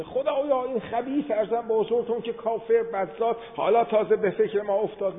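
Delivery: high-pitched imitated protest; retold indignant objection; Moses. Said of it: "حضرت موسی مثل ما شاخسینی بود".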